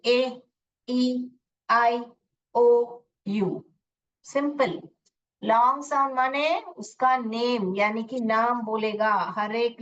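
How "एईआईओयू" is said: एईआईओयू stretched out letter by letter; letter names said slowly. Shown as a demonstration. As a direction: sing the vowel names slowly. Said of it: "हम उसका नाम ही बोलेंगे". (धीरे-धीरे स्वरों के नाम)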